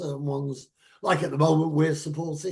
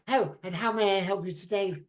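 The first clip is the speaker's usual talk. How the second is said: higher-pitched posh shop assistant; mock posh; 4 semitones higher than usual. Posh Waitrose assistant offering help to a customer, mock posh. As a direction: put on a posh customer-service voice. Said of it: "everybody talks very posh".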